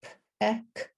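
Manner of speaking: popped "P", then "ek" apart; sounded out. Shown as a demonstration. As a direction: separate the sounds in "P, ek". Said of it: "individual sounds in peck".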